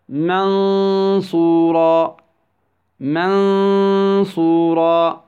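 منصورا very slow, with a long hummed half-hidden n; ikhfa with ghunnah. Shown as a demonstration. Tajweed teacher models the rule, long humming ikhfa in منصورا. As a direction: hold the noon as a nasal hum before the saad in منصورا. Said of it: "ننطقها في حالة بين الإدغام والإظهار".

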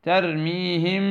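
ترميهم slow, meem hidden with nasal ghunna before بحجارة; ikhfaa demo. Shown as a demonstration. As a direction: drag ترميهم with two-count ghunna. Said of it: "الغنة موجودة". (ترميهم بغنة حركتين)

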